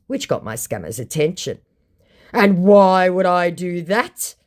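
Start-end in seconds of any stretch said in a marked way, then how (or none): 2.3-4.3 slow, louder mock indignation; indignant. Scammer bristles at being asked to call, mock indignant. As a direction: play the offended scammer. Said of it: "came the indignant response".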